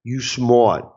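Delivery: a quick, light [name] catchphrase. Retold or imitated. Sales coach tacks a rapper's catchphrase onto mantras, lightly imitated. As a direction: toss it off like [name] hyping you up. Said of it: "throw a little [name] in there".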